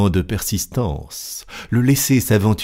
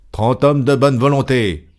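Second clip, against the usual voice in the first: raised, high officer's call; calling to soldiers; 6 semitones higher, 5 dB louder than usual. A narrator's reading. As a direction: call out for volunteers, loud and high. Captain calls out to his soldiers.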